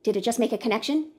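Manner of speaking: ordinary talk, nothing marked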